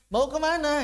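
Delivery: "Mau kemana" pitched high and loud; "Mau kemana" as an exaggerated everyday question; natural question tune. Shown as a demonstration. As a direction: ask "Mau kemana" emphatically. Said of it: "Kalau mau nanya itu".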